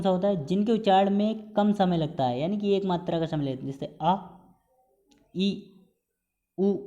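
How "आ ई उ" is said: "आ", "ई", "उ" each clipped to one beat; short vowels. Shown as a demonstration. Teacher demonstrating short one-beat vowels to students. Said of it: "इसमें केवल बस एक मात्रा का समय लग रहा है".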